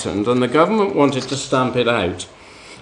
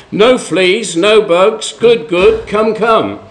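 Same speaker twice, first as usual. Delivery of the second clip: put-on Gladys in a Cockney accent; broad Cockney accent, lightly done; 6 semitones higher, 3 dB louder, slower than usual. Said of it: "in a strong Cockney accent".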